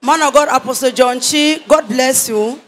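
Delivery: ordinary talk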